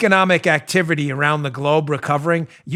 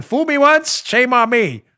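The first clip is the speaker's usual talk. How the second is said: a hint of Bush, higher and laughing; mock Bush; 7 semitones higher, 4 dB louder, slower than usual. Host mocking Bush's famous flub for listeners, lightly voiced. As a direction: lightly imitate Bush fumbling the line. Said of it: "Bush could never get that right".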